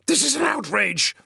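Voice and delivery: outraged. Acted. Senator pinned, outraged.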